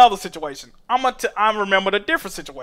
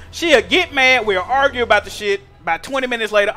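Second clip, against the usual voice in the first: ordinary delivery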